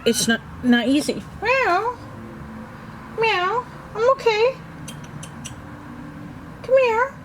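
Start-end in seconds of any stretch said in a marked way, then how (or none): 1.4-3.6 high meow straight back at the cat; answering meow. Real, not acted. Scene: meowing back to her cat from the book nook, high meow. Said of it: "the cats will meow and I answer them". 3.9-7.1 much higher pet-talk voice; reassuring the cat. Real, not acted. Scene: answering her meowing cat, high pet-talk reassurance. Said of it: "let them know I'm okay".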